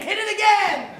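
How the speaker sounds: loud showman call for the band; game show host bark; shouted cue. Acted. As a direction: holler at the band. Host calling for music cue.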